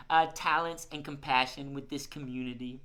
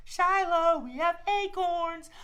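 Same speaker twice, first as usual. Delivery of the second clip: put-on much higher, slow kid-like call; sweet high call; 13 semitones higher, 5 dB louder, slower than usual. Shown as a demonstration. Host models for kids offering the squirrel acorns, much higher. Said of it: "Hold out your hand ... and say".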